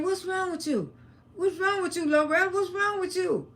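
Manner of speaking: high-pitched urgent questioning, imitating her ex-husband; retold alarmed questioning. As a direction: voice jumps high, as if demanding an answer.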